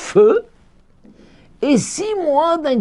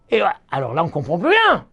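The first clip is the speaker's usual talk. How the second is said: ordinary talk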